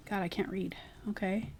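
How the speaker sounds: whisper. Real, not acted. Reading tiny text, aside.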